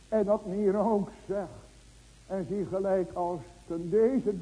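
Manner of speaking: loud, driving sermon voice; preaching at full force to the church; fervent preaching. Acted. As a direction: hammer each question home to the congregation.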